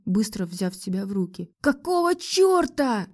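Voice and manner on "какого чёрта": very high, drawn-out outcry; slow, high-pitched exclamation of disbelief; exclaiming. A narrator's reading. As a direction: cry out in bewildered outrage. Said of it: "воскликнула я".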